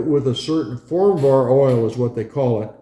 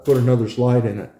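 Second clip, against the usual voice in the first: ordinary delivery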